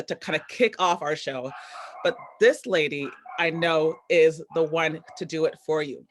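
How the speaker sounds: usual speaking voice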